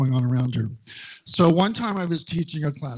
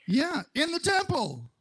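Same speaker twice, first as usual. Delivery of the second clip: high emphatic aside; 13 semitones higher, 4 dB quieter than usual. Real, not acted. Emphatic aside about Jesus.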